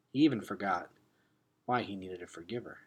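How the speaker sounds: plain reading voice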